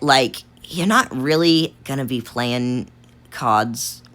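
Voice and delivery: slow, halting, stilted delivery; odd, drawn-out manner. Real, not acted. Player stuck explaining a card, speaking oddly slowly. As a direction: stretch each word out strangely and pause before the last. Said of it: "Why am I speaking like this?".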